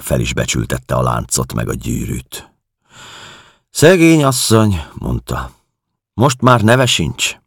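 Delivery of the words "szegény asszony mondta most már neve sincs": high, raised caretaker's voice; caretaker woman. Acted. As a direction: voice the caretaker much higher and louder. (magas, emelt hangú házmesternő)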